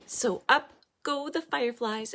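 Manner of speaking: ordinary delivery, nothing marked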